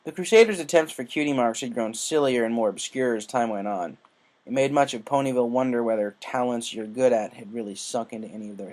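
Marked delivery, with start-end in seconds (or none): none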